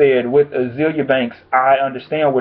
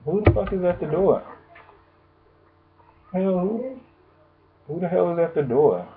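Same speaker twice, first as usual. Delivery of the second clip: called out away from the mic, softer and higher; distracted shout toward the door; 3 semitones higher, 6 dB quieter than usual. Real, not acted. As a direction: turn away and ask who's at the door. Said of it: "now that was a distraction".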